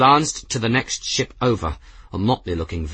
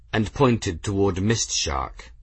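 ordinary delivery, nothing marked

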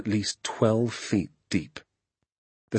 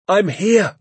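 raised, high distant call; 12 semitones higher, 7 dB louder than usual. A narrator's reading. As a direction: call back from far off in the woods. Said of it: "the voice called out".

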